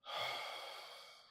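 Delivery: long, airy exhale; heavy sigh. Acted. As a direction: sigh out slowly after a grim memory. Pause after grim memory.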